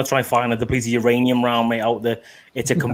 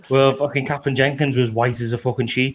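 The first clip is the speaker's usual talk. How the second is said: usual speaking voice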